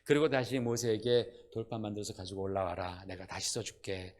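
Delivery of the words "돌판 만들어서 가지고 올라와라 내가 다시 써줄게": low, much softer, gentle invitation; kindly God. Acted. God gently inviting Moses back up, kind and soft. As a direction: speak like a kind friend reassuring. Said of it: "아주 친절하게 하나님 말씀하시는 거예요".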